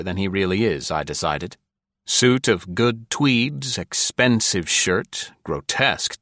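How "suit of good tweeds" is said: ordinary delivery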